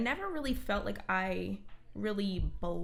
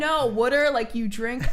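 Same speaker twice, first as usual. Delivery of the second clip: loud, higher, emphatic correction; exasperated insistence; 3 semitones higher, 9 dB louder than usual. Retold or imitated. Customer explaining water to a baffled bartender, loud exasperation. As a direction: insist loudly, as if to someone not getting it. Acted exasperated order at bar.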